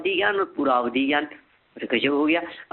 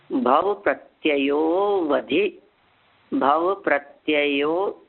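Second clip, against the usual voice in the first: drawn-out syllable by syllable; very slow recitation; much slower than usual. Shown as a demonstration. Teacher reciting slowly so students can repeat. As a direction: spell the sutra out slowly for students. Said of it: "देखिए बहुत धीरे धीरे बोलता हूँ".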